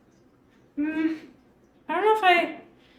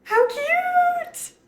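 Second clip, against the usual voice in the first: squealing high with delight; excited squeal. Real, not acted. Showing off her new apron.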